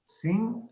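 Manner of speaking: "Cinco" with CIN held high and co dropped away; stress on CIN. Shown as a demonstration. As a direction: stretch and lift the first syllable of "Cinco". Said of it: "Palabra grave". ("Cinco" con acento alargado y agudo en CIN)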